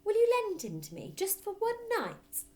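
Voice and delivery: Matilda pleading. Acted. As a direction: coax Fred sweetly.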